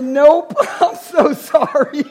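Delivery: loud, laughing nope and a slow apology; loud laughing mock apology; laughing refusal. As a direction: refuse her cheerfully through laughter. His own retold refusal, laughing.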